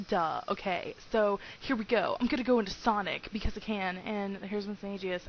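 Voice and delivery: ordinary talk, nothing marked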